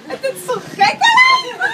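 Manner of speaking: very high, loud, slow disbelieving shriek; stunned shriek. Retold or imitated. Girlfriend shrieking at the surprise proposal, very high and loud. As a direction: squeal it out in shock.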